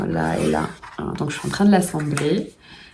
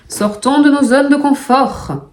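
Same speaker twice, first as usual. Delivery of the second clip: high, loud mock German accent; playful German accent, slow and loud; 8 semitones higher, 5 dB louder than usual. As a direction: declaim slowly in a put-on German accent. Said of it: "pourquoi j'ai pris un accent allemand".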